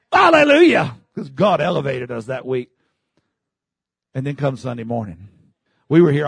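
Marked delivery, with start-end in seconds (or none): 0.1-1.0 high, loud hallelujah; joyful shout. Retold or imitated. Preacher recalling revival week, shouting hallelujah. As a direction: burst out in praise.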